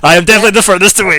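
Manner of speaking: high, loud and fast insistence; eager player. Retold or imitated. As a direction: rush it out, overeager. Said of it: "I love how Chris is like".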